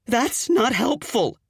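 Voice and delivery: high, slow exasperated snap. Acted. Fiancée snaps at Kip's oatmeal joke, high exasperated. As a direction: let the frustration burst out.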